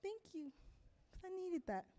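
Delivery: a hint of a friend saying thanks, lifted high; grateful friend. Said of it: "They're like".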